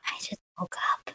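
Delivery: near-whispered, voiceless excuse; sheepish murmur. Acted. Caught looking rough in a selfie, sheepish murmur. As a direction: mumble it under your breath. Embarrassed reply about bad selfie.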